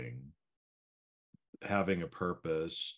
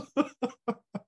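high laugh; 6 dB louder than usual. Real, not acted. Teasing back and forth.

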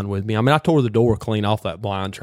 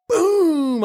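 mock gunshot. Imitating the rifle shot.

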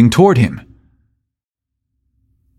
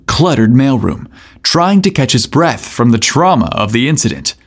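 plain reading voice

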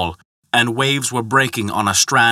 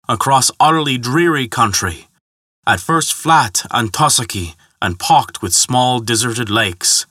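ordinary delivery, nothing marked

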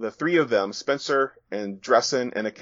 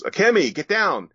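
high, loud urgent shout; yelled warning; 10 semitones higher, 5 dB louder than usual. Acted. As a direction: yell it out as a sudden warning. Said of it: "someone yells".

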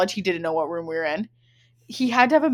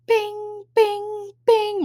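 phone pings; 12 semitones higher, much slower than usual. Retold or imitated. Imitating phone notification sounds.

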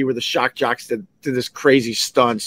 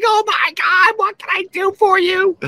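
much higher gushing woman's voice, fussy and eager; fussing helper woman. Retold or imitated. Woman rushing to a fallen lady, much higher fussing voice. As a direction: play a flustered lady rushing to help. Another woman rushes to help.

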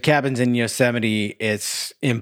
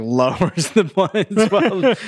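normal talking voice

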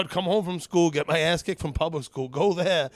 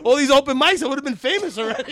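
higher, louder, laughing brag; excited joking; 9 semitones higher, 8 dB louder than usual. Real, not acted. Joking about fame at open mics, excited. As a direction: hype it up through laughter.

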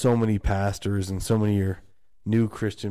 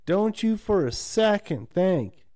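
scolding parent; 7 semitones higher, slower than usual. Retold or imitated. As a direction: lecture him slowly and sternly, voice raised. Family scolding the son.

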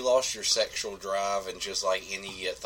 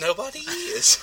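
excited exclamation; 6 dB louder than usual. Real, not acted. Joke that nobody listens.

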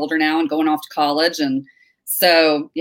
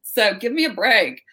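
younger self; 7 semitones higher than usual. Retold or imitated. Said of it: "And I'm like".

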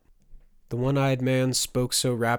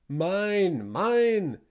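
much higher, drawn-out bellow; foghorn bellow; 8 semitones higher, 4 dB louder than usual. A narrator's reading. One-eyed man bellows at the peasants like a foghorn. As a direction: call it out long and swooping, like a foghorn. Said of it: "He bellowed like a foghorn".

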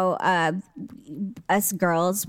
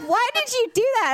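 high-pitched daughter; 13 semitones higher, faster than usual. Retold or imitated. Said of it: "She's like".

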